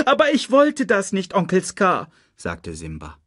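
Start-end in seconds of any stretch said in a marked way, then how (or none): 0.1-2.1 high desperate plea; pleading cub. A narrator's reading. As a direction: beg the uncle to understand. (flehender Welpe, hoch)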